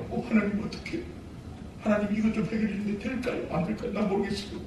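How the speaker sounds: soft, fretful mumbling to God; whiny prayer. Retold or imitated. Believer fretting in prayer, soft and whiny. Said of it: "들어보면 기도가 아니고 넋두리야".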